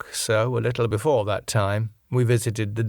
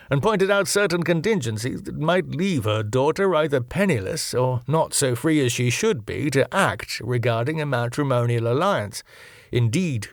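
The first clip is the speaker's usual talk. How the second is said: higher, fussy lawyer voice; a brisk, self-satisfied family solicitor; higher-voiced lawyer pleased with his own judgment; genial solicitor; 3 semitones higher than usual. Solicitor explains the will.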